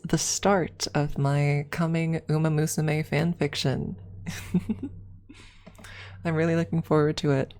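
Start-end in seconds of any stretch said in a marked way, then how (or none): 4.2-5.0 breathy little laugh; a quick airy giggle; light unvoiced chuckle; soft laugh. Real, not acted. Streamer laughs at own fanfiction.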